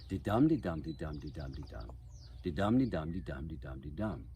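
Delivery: de-dum in fives, DUM stressed, tailing off; iambic rhythm. Shown as a demonstration. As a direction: beat out two lines of de-dum, weak-strong, each falling in pitch. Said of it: "a Shakespeare speech might go like this".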